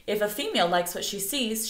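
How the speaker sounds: ordinary delivery, nothing marked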